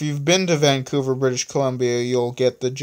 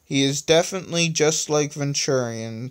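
plain reading voice

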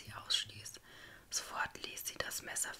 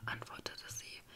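ordinary delivery

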